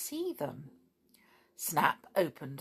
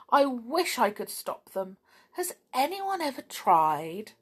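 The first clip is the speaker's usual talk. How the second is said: complaining; 7 semitones higher, 4 dB louder than usual. A narrator's reading. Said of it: "She complained about the quarrelling".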